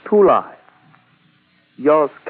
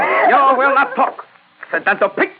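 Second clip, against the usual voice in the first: snarling threat; 13 semitones higher than usual. Acted. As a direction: spit the insult at him. Colonel threatens the prisoner.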